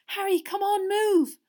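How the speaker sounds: high, loud, urgent plea; panicked urging. A narrator's reading. Hermione tugging Harry backwards, panicked urging. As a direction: hurry them away in alarm. Terrified friend tugging him away.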